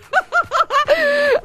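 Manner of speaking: bright high laughter. Real, not acted. Flattered host laughing on air, high laugh.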